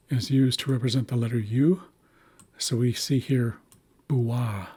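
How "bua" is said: "bua" with an oo after the b; oo sound. Shown as a demonstration. Teacher reading an example word, "bua" with oo sound. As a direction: say "bua" as boo-ah. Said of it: "meant to make an oo sound".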